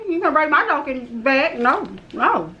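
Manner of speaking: ordinary delivery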